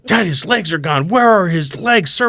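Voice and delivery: high, insistent shouted questions; loud, tactless child. Retold or imitated. Daughter to dad and a wheelchair user, loudly insistent. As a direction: imitate a kid loudly demanding answers.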